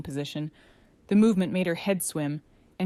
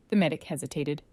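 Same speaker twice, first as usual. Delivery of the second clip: ordinary delivery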